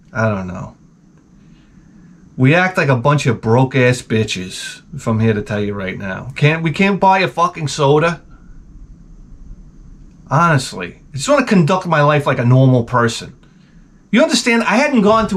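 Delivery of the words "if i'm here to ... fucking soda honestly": ordinary talk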